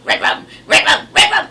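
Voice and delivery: put-on high, loud, breathy creepy child chant; demonic kid voice. Son croaking red rum from behind the couch, creepy demonic child voice. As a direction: rasp out red rum like a possessed little boy. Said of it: "in that little demonic kid voice goes".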